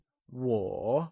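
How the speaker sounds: 我 with a drawn-out dip and rise; careful third-tone model of 我; falling-rising tone. Shown as a demonstration. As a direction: drop the pitch on 我, then lift it. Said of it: "it is said with a falling".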